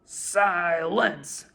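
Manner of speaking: loud barked command. A narrator's reading. King silencing the turtle Mac. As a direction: bark it to shut him up. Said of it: "the king of the turtles barked back".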